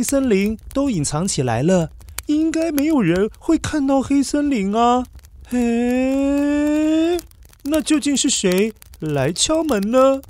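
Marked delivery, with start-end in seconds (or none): none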